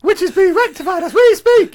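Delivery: high squeaky quoted voice; helium voice. Retold or imitated. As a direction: sound like you sucked helium. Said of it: "why does he speak in a helium voice".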